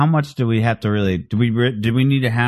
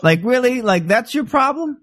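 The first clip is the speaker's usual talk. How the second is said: incredulous; 11 semitones higher than usual. Acted. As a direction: scoff at them in disbelief, much higher. Mocking the easily offended.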